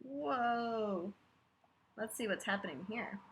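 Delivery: plain reading voice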